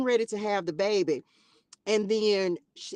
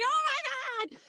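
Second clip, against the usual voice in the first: much higher, a touch of tearful awe; teary oh my God; 4 dB quieter, faster than usual. Retold or imitated. Student nurse overwhelmed at being allowed to stay, high and teary. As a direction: let it catch like you're tearing up. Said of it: "I just started crying".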